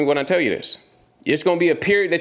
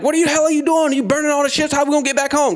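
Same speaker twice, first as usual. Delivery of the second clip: angry protest; 10 semitones higher than usual. Retold or imitated. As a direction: shout at the leader in disbelief. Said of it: "they was getting mad".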